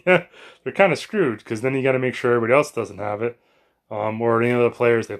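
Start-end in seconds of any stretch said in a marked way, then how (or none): 0.0-1.4 laughing. Real, not acted. Laughing at the team's plight.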